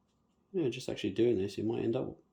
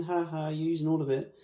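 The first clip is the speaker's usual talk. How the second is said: mock viewer; 6 semitones higher than usual. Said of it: "I know you're watching saying".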